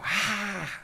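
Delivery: high, stifled mock shout; airy retold yelp, held back in volume. Storyteller re-enacting his cry at the bird hit, mock shout. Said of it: "And I shouted. I go".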